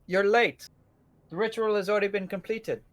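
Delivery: put-on menacing Dutch-accented villain. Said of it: "thick, uh, Dutch accent".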